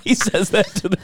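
usual speaking voice

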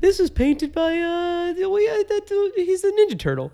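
high, dim-witted tourist voice; clueless tourist. Retold or imitated.

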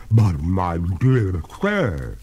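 slow, loud ghostly protest; reluctant ghost. Acted. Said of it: "with a pale reluctance".

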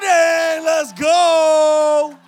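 loud, high, drawn-out hype yell; hyped shout. Retold or imitated. Preacher reliving getting bumped up, shouting with hype. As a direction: let it rip like you can't believe it.

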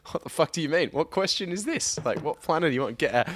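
high, rattled-off disbelief; mock-exasperated questioning; mock incredulity. Acted disbelieving reply to absurd question.

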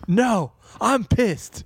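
high, slow, stroppy retort; mock angry refusal. Fire yeller refusing firefighters' request for help, mock pissed. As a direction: stretch the refusal out indignantly.

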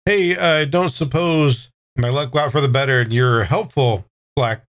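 usual speaking voice

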